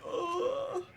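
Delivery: high, strained whimpering ugh. Retold or imitated. Telling viewers how takeoff feels, high whimpering ugh. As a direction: let out a tiny scared whine. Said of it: "I'm like".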